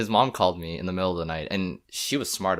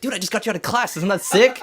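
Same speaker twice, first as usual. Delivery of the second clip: much higher, louder excited brag; thrilled friend; 13 semitones higher, 8 dB louder than usual. Retold or imitated. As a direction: brag it like you pulled off a heist.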